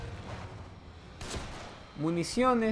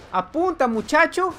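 loud, higher command; urging shout; 9 semitones higher, 7 dB louder than usual. Acted. Gamer ordering his partner to aim, loud shout.

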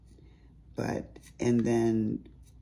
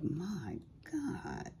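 whispered awe; 8 semitones higher, 9 dB quieter than usual. Retold or imitated. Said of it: "I'm like".

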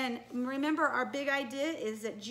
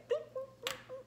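soft high robot boops; robot beeps; 12 semitones higher, 7 dB quieter, much faster than usual. Shown as a demonstration. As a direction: beep quietly like a little robot. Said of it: "Can you do a robot?".

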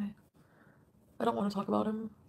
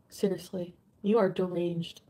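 usual speaking voice

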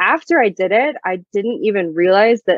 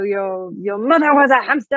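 French taunter voice; 3 semitones higher than usual. Retold or imitated. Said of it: "the full impression".

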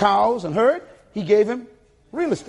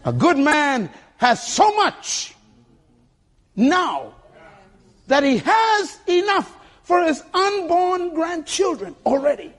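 slow, raised preaching delivery with long pauses; said slowly; 9 semitones higher, slower than usual. Shown as a demonstration. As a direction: spell it out phrase by phrase, high and drawn out. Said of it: "Let me say it slow".

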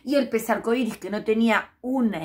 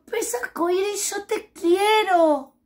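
much higher, slow, beaming "te quiero"; happy little fish thanking the rainbow fish; overjoyed little fish; 11 semitones higher, 6 dB louder, slower than usual. Acted. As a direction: gush with delight and gratitude. Grateful little fish declares love.

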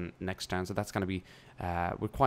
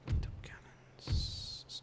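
whisper; 8 dB quieter, much slower than usual. Real, not acted. Whispered aside while picking unit.

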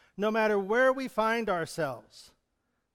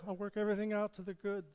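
much softer quick echo; 8 dB quieter, faster than usual. Shown as a demonstration. Preacher echoes God's promise softly. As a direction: send it back quietly like an echo. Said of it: "echo his promises".

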